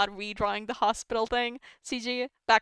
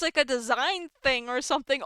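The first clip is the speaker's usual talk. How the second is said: ordinary talk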